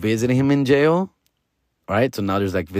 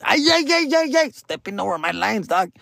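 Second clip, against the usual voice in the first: mocking whine; 9 semitones higher than usual. Annoyed by actors stepping on lines.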